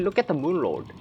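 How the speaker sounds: ordinary talk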